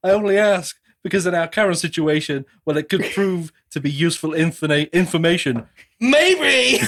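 high, mannered delivery exploding on the last word; over-emphasise single words, punch a very high "Maybe"; Nicolas Cage. Retold or imitated. Imitating a movie hero angling for useful information, hammed-up and erratic. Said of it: "It's like the complete Nicolas Cageism".

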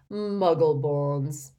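loud, slow, drawn-out disdain; pompous, lofty sneer; scornful. Acted. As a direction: draw the word out with contempt. Portrait sneers after sniggering.

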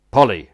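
"Polly." confirmed with a firm falling tone; firm confirmation. Real, not acted.

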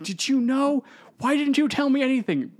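high, loud accusing demand; angry outburst. Retold or imitated. Hero yelling at his adoptive father, high and loud. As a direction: confront the mentor in anger. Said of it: "He is so angry at Broom".

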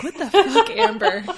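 high, loud mock outrage breaking into laughter; upset outburst. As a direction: blurt it out aghast, half laughing. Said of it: "I love how upset they were".

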